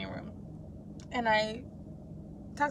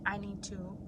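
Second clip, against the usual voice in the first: quiet self-talk; 3 semitones lower, 12 dB quieter than usual. Retold or imitated. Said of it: "have an honest talk with yourself".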